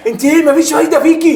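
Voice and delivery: high, loud exasperated outburst; exasperated scolding. Acted. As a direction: scold her for blabbing. Husband scolds wife for telling. (توبيخ بصوت عالٍ ومتضايق)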